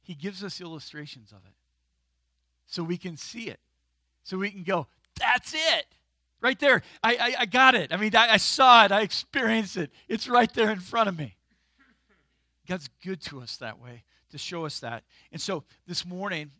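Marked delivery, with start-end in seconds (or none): 5.1-11.4 loud, high, eager "I got it!"; thrilled discovery, almost shouting; excited realization. Retold or imitated. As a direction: burst out like you finally see it. Congregant's excited realization quoted.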